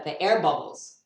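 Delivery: ordinary talk, nothing marked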